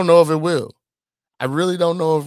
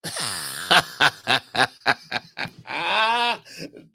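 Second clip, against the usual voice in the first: burst of laughter; 3 semitones higher, 4 dB louder than usual. Real, not acted. Laughs at short-people jab.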